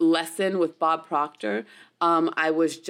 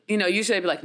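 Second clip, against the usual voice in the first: ordinary talk, nothing marked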